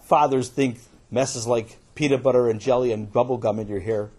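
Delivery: usual speaking voice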